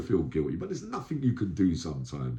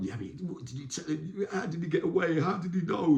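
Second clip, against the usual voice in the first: higher, pressing voice of the other men; angry inmates; 10 semitones higher than usual. Retold or imitated. Inmates grilling Yami about the escape, accusing. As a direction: demand answers like a suspicious gang.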